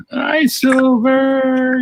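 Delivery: high, slow drawn-out hello; sing-song greeting. Real, not acted.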